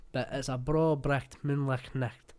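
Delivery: slow Scottish 'bro bricht moonlicht nicht' with throaty ch; broad Scottish 'moonlicht nicht', every ch rasped; Scottish tongue twister. Shown as a demonstration. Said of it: "that's like a Scottish tongue twister".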